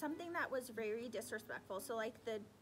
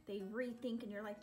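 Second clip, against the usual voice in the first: usual speaking voice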